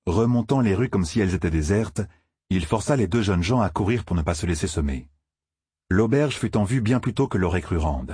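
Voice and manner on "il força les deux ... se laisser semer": plain reading voice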